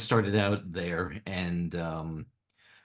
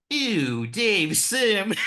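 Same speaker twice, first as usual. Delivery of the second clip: high, loud mock disgust; disgusted announcer; 12 semitones higher, 6 dB louder, much slower than usual. Award presenter opens the envelope, mock disgusted. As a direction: blurt it out in exaggerated, dismayed distaste. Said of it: "Aileen's gonna be disappointed".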